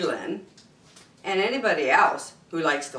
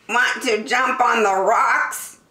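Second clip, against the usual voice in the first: higher-pitched bleating goat voice; bleaty talking goat, pitched up; storybook goat; 6 semitones higher, 3 dB louder than usual. Acted. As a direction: invite them as a goat. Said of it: "said the goat".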